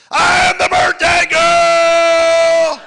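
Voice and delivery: very high, long-drawn shout; excited child's yell. Acted. Granddaughter sprinting down the hall, excited high yell. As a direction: holler it way up high, stretching every word. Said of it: "she comes zipping down the hall".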